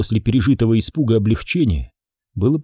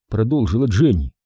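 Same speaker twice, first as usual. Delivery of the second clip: plain reading voice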